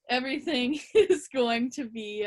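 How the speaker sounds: breaking into laughter mid-sentence; laughing. Real, not acted. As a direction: try to carry on through a real laugh. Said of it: "You laughing at my jersey?".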